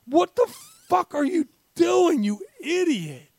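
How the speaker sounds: high, loud furious yell; angry yell. Acted. Yelling at the coach.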